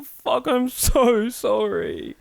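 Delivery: high, very slow mock pleading; pleading apology. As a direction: drag out a pitiful apology. Replaying his frightened apology.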